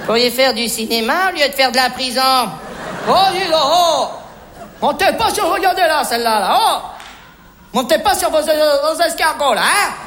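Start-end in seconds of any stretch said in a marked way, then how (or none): 3.1-9.9 sharp, emphatic telling-off; indignant scolding. Acted. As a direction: tell them off in indignant bursts. Telling Michel off. (réprimande indignée)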